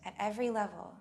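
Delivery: unhurried meditation leading, leaving space for breath; guided meditation. Acted. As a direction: guide them gently inward, phrase by phrase.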